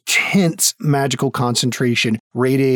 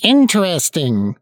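high halfling voice; 5 semitones higher than usual. Acted.

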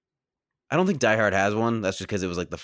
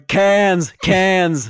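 high, loud mock holler; yelling; 10 semitones higher, 8 dB louder than usual. Film fan imitating someone yelling in the driver's ear, hammed-up shout. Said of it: "someone's yelling".

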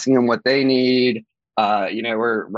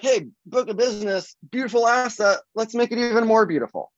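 higher-pitched, lightly acted delight; upbeat mock self-talk, pitched up throughout; appreciative; 10 semitones higher than usual. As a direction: relish it like a prized asset. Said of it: "Or you can be like".

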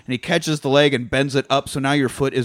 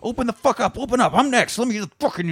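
high-pitched retold outrage; hammed-up angry urgency without much volume; mock pissed-off demand; 10 semitones higher, faster than usual. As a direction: demand entry in a raised pitch. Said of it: "You never piss off the Ip man".

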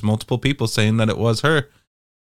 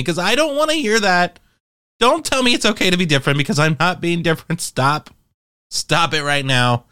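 mock rant; 7 semitones higher than usual. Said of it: "And I'm like".

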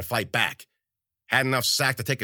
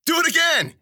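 loud, high-pitched shout; yelled command; 7 dB louder than usual. A narrator's reading. Brawler goading the narrator to knee him, yelling. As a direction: yell it out as a taunt. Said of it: "he yelled".

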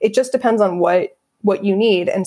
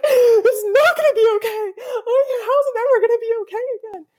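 much higher, wailing mock sob through laughter; mock sobbing. Imitating an upset teen's ugly cry, mock sobbing high. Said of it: "you just start sobbing and you're like".

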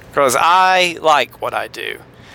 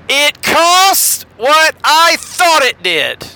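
slow, much higher coach's press-conference drawl; Romeo Crennel impression; 11 semitones higher, 3 dB louder, much slower than usual. Coach at a post-game press conference, slow imitation. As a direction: quote it like a deflated coach, slow. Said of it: "Romeo Crennel".

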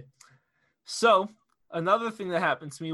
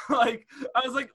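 normal talking voice

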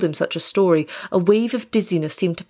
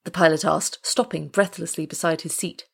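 ordinary delivery

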